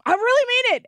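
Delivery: high-pitched imitated declaration; mock insistence. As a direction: exclaim it like a mimicked politician. Imitates president's emphatic speech line.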